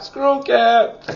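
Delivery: a teasing high echo of screw cap; playful high repeat. Shown as a demonstration. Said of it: "It is a screw cap".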